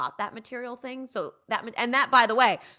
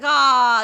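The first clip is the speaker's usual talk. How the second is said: loud, high anguished "ah"; hammed-up fatherly cry of dismay; dramatic groan; 5 semitones higher, 14 dB louder than usual. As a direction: cry out as if the car were dying. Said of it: "like, a big dramatic thing".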